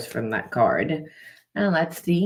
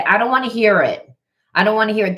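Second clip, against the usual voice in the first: higher, louder curt brush-off; dismissive brush-off; 6 semitones higher, 7 dB louder, much faster than usual. Retold or imitated. People cutting off a young Laura, annoyed brush-off. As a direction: snap it shut like you're sick of her.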